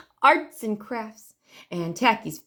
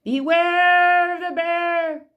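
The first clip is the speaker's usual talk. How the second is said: higher, louder and very slow bear call; slow, drawn-out louder bear warning; 9 semitones higher, 6 dB louder, much slower than usual. A narrator's reading. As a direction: draw out each word as the bear comes closer. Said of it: "The voice came closer".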